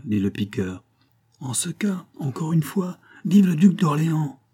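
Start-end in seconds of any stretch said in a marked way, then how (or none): 1.4-4.4 cried cheer. A narrator's reading. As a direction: start contained, build to a cheer. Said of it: "cria Billaud".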